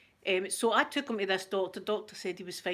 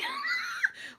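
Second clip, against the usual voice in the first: high shrieking laugh; real shrieking laugh at the memory; burst of laughter. Real, not acted. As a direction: let a sudden squealing laugh burst out. Laughing at nurse's absence.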